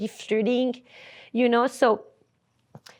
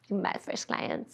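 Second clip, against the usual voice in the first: hushed memory; 3 semitones lower, 12 dB quieter, slower than usual. Real, not acted. Wistful memory, unvoiced.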